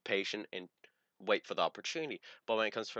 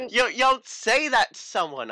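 much higher, louder indignant scolding; mock scolding; 12 semitones higher, 10 dB louder than usual. Imitating Allie scolding him after the spray. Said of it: "which was like".

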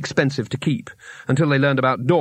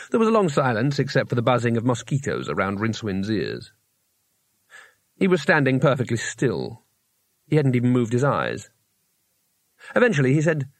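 usual speaking voice

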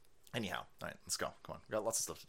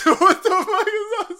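much higher, loud disbelieving laugh; laughing shock; 10 dB louder than usual. Real, not acted.

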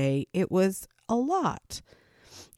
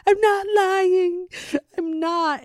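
much higher, louder, slow tearful plea; desperate crying protest; 13 semitones higher, 5 dB louder, slower than usual. Retold or imitated. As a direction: beg to be believed, near tears. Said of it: "And you're like".

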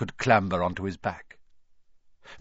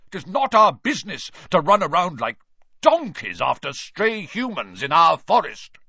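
higher, louder furious centaur; angry outburst; 6 semitones higher, 5 dB louder than usual. A narrator's reading. Bane rejects Firenze's excuse, furious loud outburst. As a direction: spit out the scorn. Bane kicks his legs in anger.

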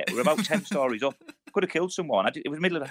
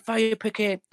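retold shout; 9 semitones higher, much slower than usual. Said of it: "Every time he shouted".

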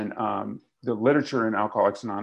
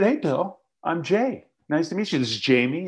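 eager greeting; 6 semitones higher than usual. Retold or imitated. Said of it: "I said".

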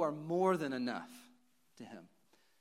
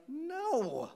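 put-on long, high-pitched kid voice; imitated children; 13 semitones higher, 3 dB louder than usual. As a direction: mimic kids answering in a high voice. Voices sons answering their dad.

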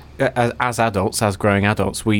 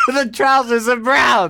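high shrieking laughter; can barely speak for laughing; 8 semitones higher, 3 dB louder, slower than usual. Real, not acted. Laughing fit.

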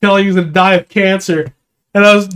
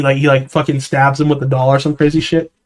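normal talking voice

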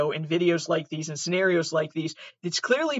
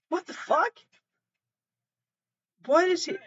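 high, stunned what the fuck; shocked; 11 semitones higher than usual. Real, not acted. Watching the car get smashed, high and shocked. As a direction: caught completely off guard.

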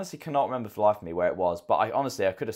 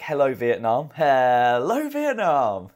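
higher, much louder drawn-out radio call; Hello Vietnam impression; 6 semitones higher, 8 dB louder, much slower than usual. Mocking the host's opening as a big radio DJ call. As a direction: boom it out like a wartime radio DJ. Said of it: "That was like".

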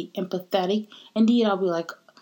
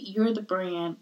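usual speaking voice

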